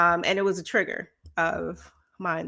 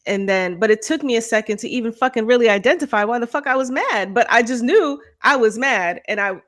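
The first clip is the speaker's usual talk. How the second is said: ordinary delivery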